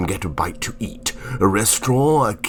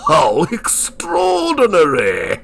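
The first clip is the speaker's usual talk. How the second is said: higher, drawn-out amazement; astonished exclamation; 7 semitones higher, 4 dB louder, slower than usual. Acted. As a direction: marvel at it, stretching the words out slowly. Narrator astonished by reappearing figure.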